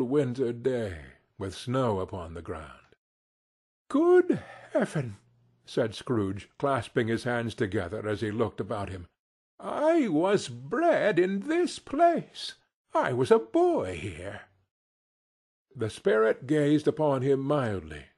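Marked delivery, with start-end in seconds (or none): 3.9-5.2 put-on much higher, astonished old man's cry; amazed cry. A narrator's reading. Scrooge amazed at old home. 9.6-14.4 wistful. A narrator's reading. Scrooge recognises his birthplace.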